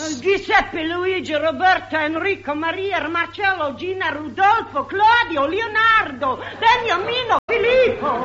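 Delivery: put-on comic Italian accent reeling off names; stage Italian, rattling through the children; hammed-up Italian mamma listing bambinos; Italian wife. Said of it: "I played Missus Guglielmo Marconi".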